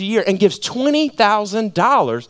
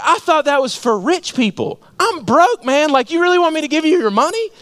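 high, incredulous objection; acted protest; 10 semitones higher, 3 dB louder than usual. Broke writer challenges his pastor, acted disbelieving protest. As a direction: rush to a high-pitched finish, imitating a baffled protester.

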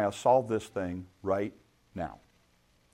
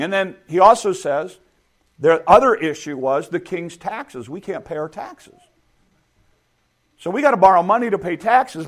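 ordinary talk

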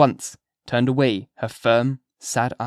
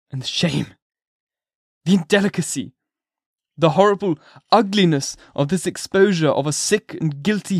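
raised, loud, bitter reproach; a broken man accusing her; anguished minister; 6 semitones higher, 5 dB louder than usual. A narrator's reading.

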